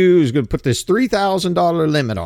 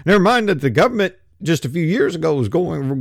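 ordinary talk, nothing marked

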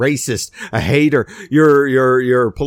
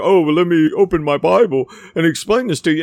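mock know-it-all; 3 semitones higher, 3 dB louder than usual. Mocking a preachy arguer.

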